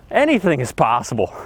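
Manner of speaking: usual speaking voice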